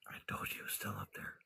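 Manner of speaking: hushed whisper so he won't hear. Real, not acted. Whispering to viewers about the animal up there. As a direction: keep it a breathy secret.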